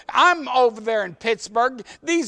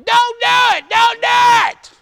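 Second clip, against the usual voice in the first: put-on old man yelling; 13 semitones higher, 8 dB louder than usual. Old man running down the hill yelling at the driver, very high loud yell. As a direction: holler it down the hill. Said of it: "He come down that hill yelling".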